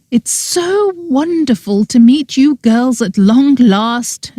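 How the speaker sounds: high, slow gushing greeting; a lightly voiced gushing aunt; gushing ghost aunt. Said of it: "she cried out".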